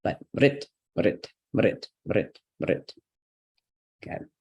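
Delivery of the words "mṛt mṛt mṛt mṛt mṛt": "Brit" as one short m-r-t syllable; syllabic r. Shown as a demonstration. Teacher drilling a vowel English lacks, syllabic-r "Brit". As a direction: say "Brit" quickly, r standing in for the vowel. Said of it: "pronunciation of this vowel that we don't".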